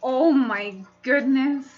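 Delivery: usual speaking voice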